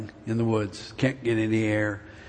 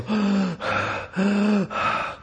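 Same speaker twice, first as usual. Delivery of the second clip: gasp; 7 semitones higher than usual. Retold or imitated. Out of breath atop the hill.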